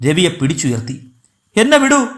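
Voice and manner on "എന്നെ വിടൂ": high-pitched plea while wrestling free; narrated cry to be let go; struggling protest. A narrator's reading. Grieving brother to those holding him, struggling protest. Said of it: "Ravi കുതറിക്കൊണ്ടിരുന്നു".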